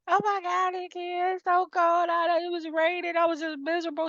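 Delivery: high, whiny dramatic friend; mock the friend's misery in a high voice; imitating her friend. Retelling friend who came home from campus, high and whiny. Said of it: "she was like".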